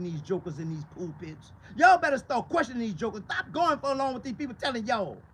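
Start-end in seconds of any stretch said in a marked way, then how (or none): 1.8-4.8 louder, hard warning. Real, not acted. Preacher warning the flock about pulpits, loud. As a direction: shout the warning to the pews.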